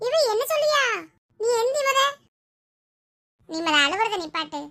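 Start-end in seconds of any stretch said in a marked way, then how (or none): 0.1-2.0 high-pitched, insistent friend. Acted. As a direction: urge her up in a high voice. Friend urges her to get up. (வற்புறுத்தும் தோழியின் உயர்ந்த குரல்)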